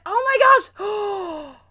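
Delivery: slow, stretched gasp of realization; realizing. Real, not acted. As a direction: drag it out in discovery. Sudden realization about candle.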